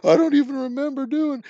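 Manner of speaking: high-pitched imitation of a sobbing apology; mock bawling. Teller as his younger self phoning brother's girlfriend, mock bawling. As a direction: wail it in a high mock-crying voice. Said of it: "I called her up just bawling".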